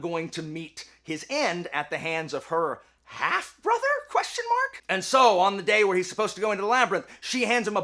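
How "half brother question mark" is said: comically uncertain, very high rise; high questioning squeak. Real, not acted. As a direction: pitch shooting way up in doubt. Doubting the half-brother relation.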